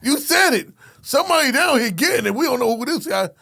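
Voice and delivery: high, loud urgent call; high and loud officer calling in the trouble; excited radio call. Retold or imitated. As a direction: report the chaos in a rush of excitement.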